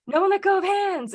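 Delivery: high-pitched panicked command, retold; acted-out alarm, pitch jumping up; imitated panic. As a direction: mimic the guide panicking. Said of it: "he started panicking too".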